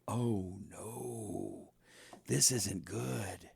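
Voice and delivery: hushed oh no; quiet dread. Retold or imitated. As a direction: barely voice it, sinking dread. Inner fear while interrogated.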